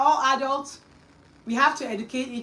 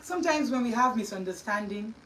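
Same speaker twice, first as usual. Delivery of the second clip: ordinary talk, nothing marked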